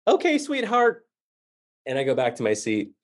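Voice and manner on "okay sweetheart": put-on high, sweet old-lady voice; a lightly voiced old woman soothing him; elderly lady. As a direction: pat him on the head with it. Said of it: "One of the ladies ... said".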